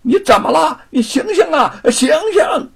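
high, loud, panicked calling; frightened shouting. Acted. Servant frantically calling his fallen master to wake up, panicking. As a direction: shout desperately to wake him. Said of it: "不由吓得大声地喊起来".

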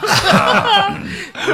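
sudden high-pitched laugh; burst of laughter. Real, not acted. Laughs at host's joke. (突然大笑)

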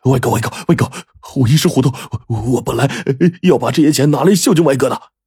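begging underling. Acted. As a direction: grovel and beg for mercy.

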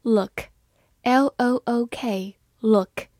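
letter by letter, very slow; slow spelling. Shown as a demonstration. Teacher spelling a word slowly for students. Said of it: "spelling demo".

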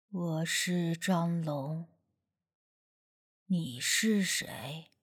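low, slow, hushed and heavy; a ghost speaking through a boy, very slow; spirit-possessed voice. Acted. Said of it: "鬼仙上身时说话的口气".

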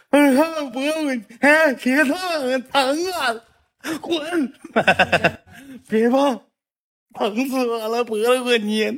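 put-on high, petulant child voice complaining of pain; whiny hurt kid. Boy telling grandpa not to touch his sore neck, high and whiny.

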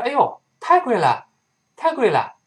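high-pitched exclamation of dismay; customer balking at price. Acted. Customer reacts to sixty dollars, high dismayed exclamation. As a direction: protest the price, pitched well up. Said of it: "这人说".

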